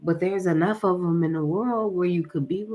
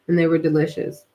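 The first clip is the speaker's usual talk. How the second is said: usual speaking voice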